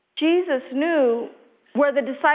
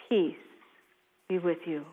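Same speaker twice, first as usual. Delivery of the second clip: hushed blessing; 9 semitones lower, 9 dB quieter than usual. Acted. Blessing offered softly to listeners.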